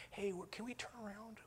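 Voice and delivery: small, high, very soft voice; sheepish request. Retold or imitated. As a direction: ask timidly, barely daring to be heard. Sheepishly asking upset relatives.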